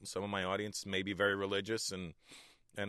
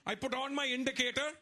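put-on much higher, indignant Indian dad accent, laughing. Comedian voicing his dad, indignant much higher Indian accent. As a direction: protest like an offended father. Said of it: "I was like, "Dad, was this legal?".

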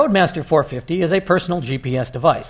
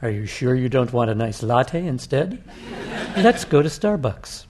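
silky machine voice; 4 dB quieter than usual. Acted. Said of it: "the silky voice of the machine".

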